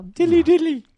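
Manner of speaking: mock herald call. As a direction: proclaim it like a commercial herald. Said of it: "this damn Bud Light commercial".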